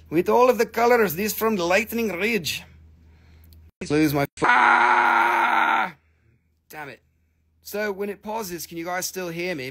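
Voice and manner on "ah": sudden high shout of frustration; real annoyed outburst; frustrated scream. Real, not acted. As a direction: yelp at the frozen stream. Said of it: "Because I keep screaming".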